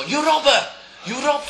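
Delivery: high, loud mock yell; high-pitched shout. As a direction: shout it like an angel. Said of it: "If an angel would go".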